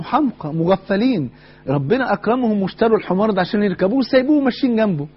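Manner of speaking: hammed-up mocking onlookers; mocking scorn. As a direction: sneer at the fools walking beside their donkey. Onlookers mocking Juha and son.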